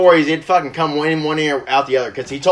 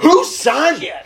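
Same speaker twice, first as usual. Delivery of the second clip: high-pitched imitation of an alarmed dad; acted-out indignant outburst; freaked out and mad; 11 semitones higher, slower than usual. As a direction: demand it in mock panic, pitch shooting up. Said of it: "freaked out and fucking mad".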